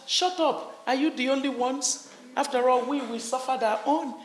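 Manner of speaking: high, sharp brush-off, mock-quoting the others; dismissive retort. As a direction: snap back as if telling them to quit whining. People dismissing a suffering group.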